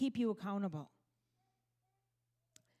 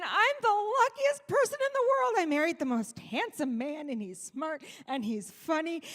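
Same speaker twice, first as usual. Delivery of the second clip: starry-eyed; 5 semitones higher than usual. Retold or imitated. Starry-eyed bride remembering.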